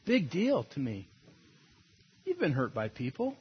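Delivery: normal talking voice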